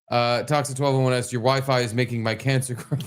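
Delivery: ordinary talk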